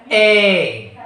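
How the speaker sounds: "a" gliding to an ee; isolated "a" sound for learners; diphthong demo. Shown as a demonstration. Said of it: "that is sounded as".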